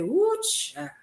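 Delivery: put-on high woo child, drawn out; a light woo child; my catchphrase. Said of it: "I hear myself say".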